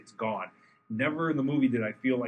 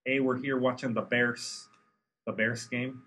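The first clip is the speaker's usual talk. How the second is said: put-on slow, lightly done Chicago accent; a hint of a Chicago sports fan accent; slower than usual. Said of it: "you don't like the Chicago accent?".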